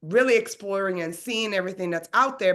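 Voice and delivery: ordinary delivery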